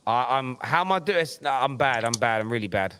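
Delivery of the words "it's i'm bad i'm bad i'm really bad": ordinary talk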